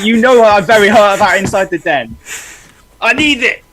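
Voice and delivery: high, loud, hammed-up wounded whine; hammed-up mock distress; mock hurt. Mock hurt over delayed show.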